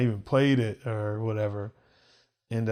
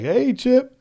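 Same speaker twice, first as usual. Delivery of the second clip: bright upswing, far higher pitch; mock eager greeting; 12 semitones higher than usual. Student mimicking a chummy hello to his teacher, mock eager. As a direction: hail someone with hammed-up eagerness. Mimes greeting he didn't give.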